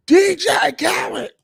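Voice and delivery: hammed-up imitation of the DJ tag. [name]'s signature hype drop.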